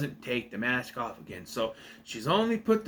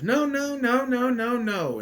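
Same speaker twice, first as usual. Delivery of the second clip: high, panicked run of noes, a mock freak-out; 11 semitones higher, 4 dB louder, slower than usual. Carly Beth, chased by the masks, panics with high cries. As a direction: shriek no over and over in mock terror. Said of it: "She goes".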